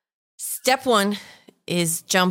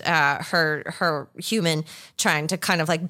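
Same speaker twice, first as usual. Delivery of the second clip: usual speaking voice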